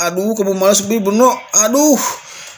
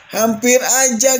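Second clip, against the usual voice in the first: ordinary talk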